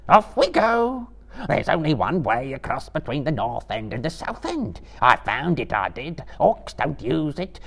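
very high, skipping creature voice; eager Gollum. A narrator's reading. Gollum agreeing to lead the hobbits, high eager creature voice. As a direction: bounce it out eagerly.

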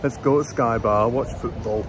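loud, mock northern English accent; a hammed-up northern English football fan. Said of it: "Can't even do a northern accent".

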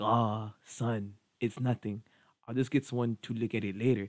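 put-on soft, low-key old grandpa voice with an accent; wise grandpa accent. Chill grandfather brushing off his grandson's worry, soft put-on accent. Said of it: "I don't know what kind of accent".

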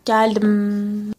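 ordinary talk, nothing marked

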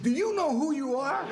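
high, loud, laughing squeal; amazed sailor. Retold or imitated. Hitchhiking sailor recognizing the star in his car, squealing high. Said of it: "he said".

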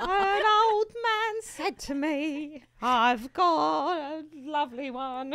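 high, slow sung character voice; Debbie. Acted. Duet demo for friends, singing as a high-voiced character. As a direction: sing it high and drawn out in character. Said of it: "And then Debbie go".

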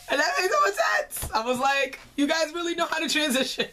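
high excited voice. Real, not acted. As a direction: gush with excitement. Excited about transition.

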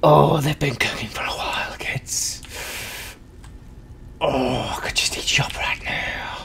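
put-on breathy creepy whisper to children. Cook whispering to kids over the pans, creepy whisper. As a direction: hiss it like a witch over a pot.

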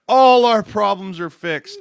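loud, high, drawn-out hype; mock fan elation. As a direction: imitate fans crowing in triumph. Voicing overjoyed fans after trade.

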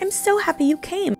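high-pitched imitation of a gushing greeting; lightly mock an overjoyed hello; squeal-like gush, acted out without extra volume.

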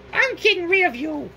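chirpy threat in a high voice; Cuphead voice. Acted. Cuphead telling the rat off, high cartoon voice. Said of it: "said Cuphead".

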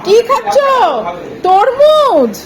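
put-on high, loud sing-song baby talk. Mother asking the baby what she eats, high baby talk. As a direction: chirp at the baby over her watermelon.

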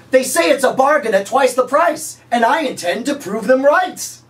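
hard sell pitch. Pretend. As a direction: push the fake deal with cheesy gusto.

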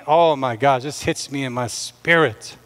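usual speaking voice

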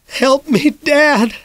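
high frightened plea; a trapped kid calling out for his father; desperate cry for help. A narrator's reading. Trapped boy crying up to his dad, high and afraid.

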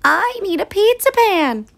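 high chirpy hen voice. A narrator's reading. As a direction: squeak it out like a little hen. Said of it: "she said".

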